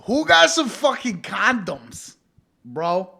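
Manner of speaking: loud, high, drawn-out call; brash shouted demand; yelling out. Acted. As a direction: holler it to the whole room. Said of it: "I was yelling out the side".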